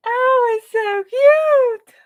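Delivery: very high, slow, loud coo; cooing squeal. Real, not acted. Crafter cooing at the finished figure, very high slow coo.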